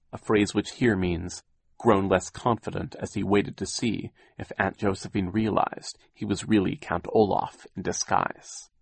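usual speaking voice

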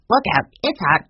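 loud, squawky parrot voice; talking bird. A narrator's reading. Old Mina the bird warning them at supper, loud squawk. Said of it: "said Mina".

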